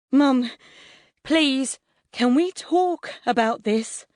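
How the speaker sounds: high, urgent begging; frantic pleading. A narrator's reading. Son begging mum to spare his computer, pleading. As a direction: beg her to talk.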